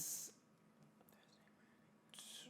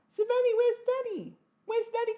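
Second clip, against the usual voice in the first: high sing-song talk to the pet, much higher; 3 dB louder than usual. Real, not acted. Owner calling to her pet Savannah, high sweet pet-talk voice.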